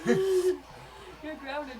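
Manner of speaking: laughing through the words. Acted. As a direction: giggle while scolding. GM repeats the punishment, laughing.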